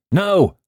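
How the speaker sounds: high, loud "No"; extraordinary emphasis. A narrator's reading. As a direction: cry "No" as an absolute refusal. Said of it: "said the voice with extraordinary emphasis".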